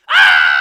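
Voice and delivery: big hammed-up yell, deep and loud; mock scream. Preacher acting the wasp for his kids, loud mock yell. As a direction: let out a cartoon yell.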